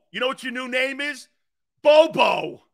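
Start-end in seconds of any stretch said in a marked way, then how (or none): 1.8-2.6 loud taunting nickname; mocking shout. Real, not acted. Taunting the receiver, loud mocking nickname. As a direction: bellow the nickname to mock him.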